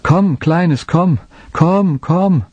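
high coaxing call to a lost lamb. A narrator's reading. Boy calling his lost lamb, high and coaxing. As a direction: call the sheep, pleading. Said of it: "zu suchen und zu rufen".

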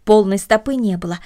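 ordinary delivery, nothing marked

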